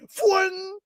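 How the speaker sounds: a high, quick fwing; fwing sound effect. Retold or imitated. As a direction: whip it out like a line snapping away. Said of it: "the line just went".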